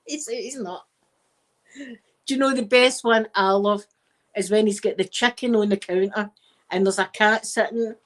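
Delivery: usual speaking voice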